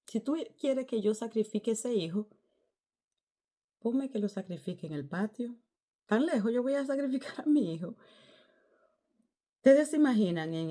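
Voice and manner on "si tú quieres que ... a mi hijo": rising high, incredulous complaint; mock outrage at the long walk; indignant protest. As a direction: push back on God in disbelief. Protesting to God.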